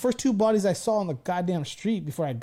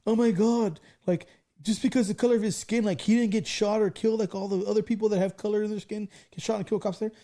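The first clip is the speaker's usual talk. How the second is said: raised, higher-pitched imitation of public outcry; mock outrage; 6 semitones higher, 3 dB louder than usual. Podcaster mimics everyone reacting to the arrest, mock outrage. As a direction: imitate an indignant crowd.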